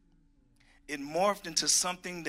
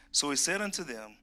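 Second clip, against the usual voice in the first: ordinary talk, nothing marked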